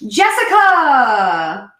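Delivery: drawn-out announcement. Real, not acted. Announcing the contest winner.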